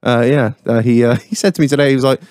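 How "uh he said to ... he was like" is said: usual speaking voice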